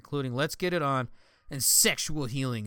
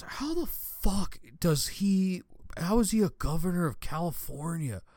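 imitating a baffled, clueless guy; incredulous guy; 6 semitones higher than usual. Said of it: "the guy goes".

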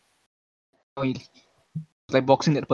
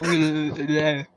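slow sounding out; much slower than usual. Real, not acted. Sounding out an unfamiliar word.

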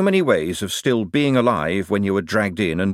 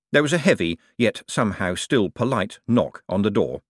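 ordinary delivery, nothing marked

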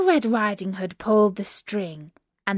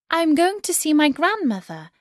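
lightly voiced girl, pitched up; 8 semitones higher, 3 dB louder than usual. A narrator's reading. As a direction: answer in a higher storybook girl's voice. Girl answers the wolf in story.

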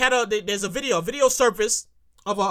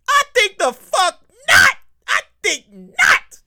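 loud, slow, emphatic outburst; exasperated comic shout; indignant refusal; 7 semitones higher, 7 dB louder, slower than usual. Acted. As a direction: belt out the refusal, stretching each word. Outraged refusal to support habit.

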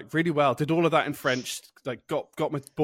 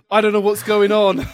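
high, loud confused outburst; confused panic; 9 semitones higher, 13 dB louder than usual. Retold or imitated. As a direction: blurt it out in a fluster. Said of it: "got very confused and went".

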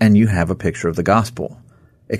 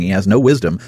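ordinary talk

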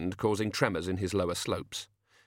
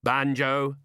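higher, sharp call; snapped; 5 semitones higher, 4 dB louder than usual. A narrator's reading. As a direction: snap the name as an order. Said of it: "snapped Tea Time".

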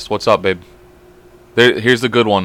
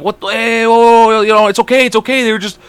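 put-on soothing child; 10 semitones higher than usual. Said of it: "And I'm like".

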